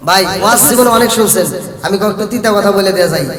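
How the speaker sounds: normal talking voice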